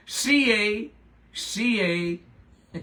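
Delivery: spelling out the letters through laughter; laughing while spelling. Real, not acted. Host cracks up on air, spelling it out with laughs. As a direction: giggle through each letter. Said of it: "how do you say it?".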